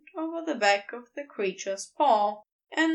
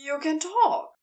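shocked near-soundless shriek; 4 semitones higher, 3 dB louder than usual. A narrator's reading. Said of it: "on a mostly soundless shriek".

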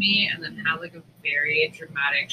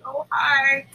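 fake cheerful greeting; 8 semitones higher than usual. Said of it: "customers are, like, see me, like".

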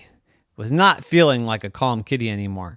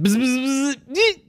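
attacking buzz; 5 dB louder than usual. Acted. Bee charging the dog, rising buzz. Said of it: "the bee went straight up to the dog".

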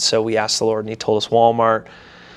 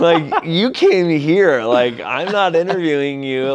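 indignant; 6 semitones higher, 4 dB louder, slower than usual. Retold or imitated. Retelling his indignation at being grilled.

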